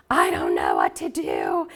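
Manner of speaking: despairing sigh. Retold or imitated. Collapsing in despair retold.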